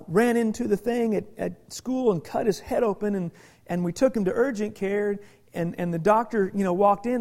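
ordinary delivery

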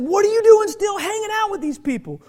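high, insistent challenge; retold scolding. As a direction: confront a friend in disbelief. Retold confrontation with friend.

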